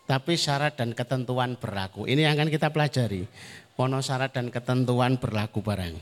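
ordinary delivery

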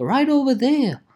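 pitched well up, singsong; high, teasing pointer; playful high repeat. Pretend.

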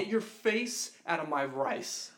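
loud, slow back the fuck up; firm mock rebuke, drawn out; telling off. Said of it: "And I'm like".

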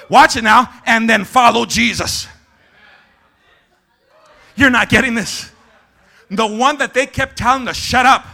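loud, punched-out delivery; forceful pulpit voice; emphatic preaching. Real, not acted.

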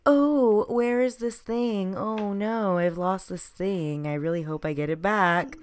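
put-on high, slow, sweet childlike voice; playing a gentle innocent child. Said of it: "you have to be like".

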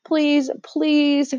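put-on high, begging boy's voice; a lightly voiced boy pleading suddenly; sudden plea. A narrator's reading. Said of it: "said Edmund suddenly".

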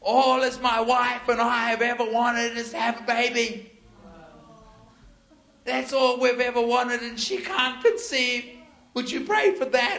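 high, loud mock sobbing plea; imitated wailing. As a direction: wail it out like a man in tears. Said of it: "And he starts wailing".

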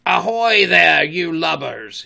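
booming pirate captain's hail; commanding sea captain's shout; Captain Hook. A narrator's reading. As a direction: call loudly across water, drawing it out. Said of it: "he now imitated the voice of Hook".

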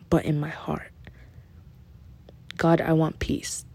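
soft whispered plea for peace; whispered prayer. Retold or imitated. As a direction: let the prayer drop to a whisper.

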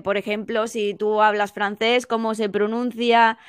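ordinary talk, nothing marked

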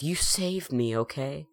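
lightly acted, insistent comforting; earnest reassurance. Quoting Mike comforting the girl, earnest reassurance.

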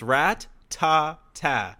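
very slow rat-ta-ta with doubled T; syllable by syllable. Shown as a demonstration. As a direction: break rat-ta-ta into careful beats. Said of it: "there's two T's in the first one".

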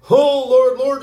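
high, loud panicked wail; desperate plea. Acted. Disciples crying to sleeping Jesus, high loud panic. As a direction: beg him to wake up, terrified.

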